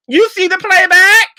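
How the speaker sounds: high, loud you see the playback?; incredulous shout. Real, not acted. Vlogger demanding Nene watch herself, loud incredulous. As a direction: yell it in full disbelief. Repeats the question, louder.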